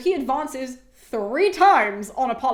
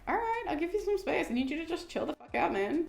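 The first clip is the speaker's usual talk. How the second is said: soft, higher-pitched backing-off voice; mock placating; 5 semitones higher, 6 dB quieter than usual. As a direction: act out calming someone who freaked out. Diomedes backing off after Apollo's outburst.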